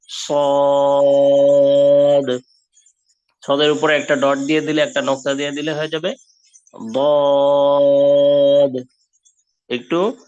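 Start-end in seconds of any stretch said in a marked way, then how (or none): 0.1-2.4 সদ drawn long, heavy and louder; long heavy sad. Shown as a demonstration. Teacher demonstrating the long heavy letter. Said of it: "six হারাকা টান হবে". 6.9-8.8 বদ drawn long, thick and louder; long heavy dad. Shown as a demonstration. Teacher demonstrating the heavy Arabic dad. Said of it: "একটু মোটা করে".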